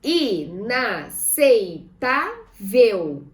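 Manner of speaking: I, NA, CEI, TÁ, VEL, loud and slow; "Inaceitável" as i... na... cei... tá... vel; syllable by syllable. Shown as a demonstration. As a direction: split "Inaceitável" into five spaced syllables. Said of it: "Vamos separar em sílabas".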